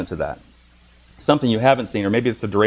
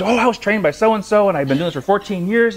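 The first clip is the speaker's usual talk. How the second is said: high, proud showing-off voice; the masseuse brags to her client, high and pleased; mock bragging masseuse; 9 semitones higher than usual. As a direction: imitate her boasting about her training. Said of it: "and so she".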